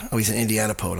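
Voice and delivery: usual speaking voice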